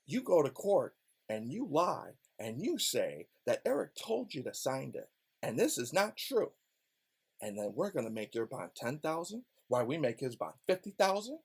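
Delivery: low, soft conspiratorial prosecutor voice; mock scheming prosecutor. Prosecutors coaching Brian to lie, hushed. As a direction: hush it like a backroom deal.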